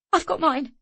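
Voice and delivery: high, shaky and afraid; shaking voice. A narrator's reading. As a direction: voice trembling with fear. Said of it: "her voice shaking".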